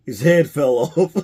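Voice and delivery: giggling while quoting the dead parakeet line; laughing quote. Retold or imitated. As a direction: laugh through the punchline. Retelling the parakeet punchline.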